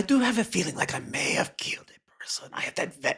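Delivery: half-whispered, halting suspicion about yourself; whispery, uneasy stranger; hushed confession. Acted. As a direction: confess it under your breath, unsure. Confessing a possible killing.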